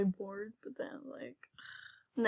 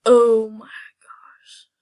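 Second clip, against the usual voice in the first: awed whisper; 11 dB louder, slower than usual. Real, not acted. Reacting to the rare pet.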